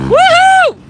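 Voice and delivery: loud high whoop of joy; big celebratory woohoo; excited cheer. Real, not acted. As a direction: cheer the rider on, full voice. Cheering rider's good run.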